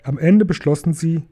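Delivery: ordinary delivery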